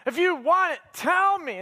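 high, drawn-out pleading; imitated whiny nagging; mock whining. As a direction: beg like a whining, nearly crying girlfriend. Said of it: "she's probably whining. She's probably crying".

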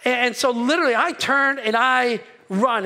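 usual speaking voice

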